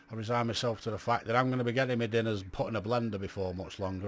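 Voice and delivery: usual speaking voice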